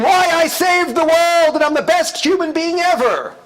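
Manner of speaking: hammed-up bragging essay title; mock grandiose. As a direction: announce it like a boastful headline. Mocking grandiose college essay title.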